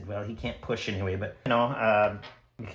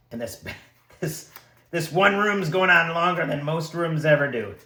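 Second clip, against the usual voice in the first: ordinary delivery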